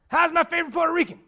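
high, loud, fast booming hello; Ronnie's loud greeting. Retold or imitated. Pastor imitating Ronnie greeting him at church, loud and fast. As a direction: boom out the welcome like a big friendly guy. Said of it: "Ronnie DeShields was at our church".

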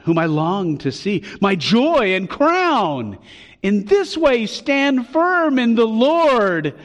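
building high, loud, drawn-out scripture reading; fervent declamation. Acted. Pastor reading Paul to his beloved brethren, fervently. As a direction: proclaim the affection with soaring fervor.

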